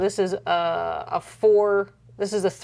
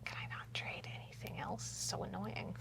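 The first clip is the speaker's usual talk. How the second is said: whispering; 19 dB quieter than usual. Real, not acted. Muttering to self over trade.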